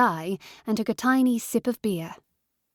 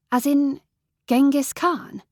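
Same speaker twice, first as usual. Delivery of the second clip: higher and louder, startled question; alarmed surprise; 6 semitones higher, 4 dB louder than usual. Acted. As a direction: caught off guard, voice jumps up. Alarmed question about Mongols.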